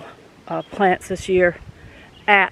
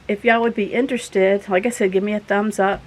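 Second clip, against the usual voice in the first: normal talking voice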